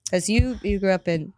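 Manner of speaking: ordinary talk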